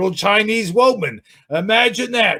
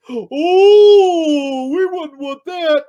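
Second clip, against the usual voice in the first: mock concern; 10 semitones higher, 5 dB louder, much slower than usual. Sarcastic jab at reviewer.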